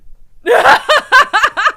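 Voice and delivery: high, loud bursting laugh; hysterical laugh. Real, not acted. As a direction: explode into helpless giggles. Laughs after mic drop.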